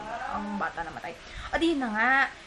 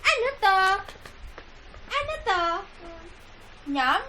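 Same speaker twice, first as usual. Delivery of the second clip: high cooing hi; 8 semitones higher, 6 dB louder, much slower than usual. Real, not acted. Mother cooing hi to her baby, high and loud.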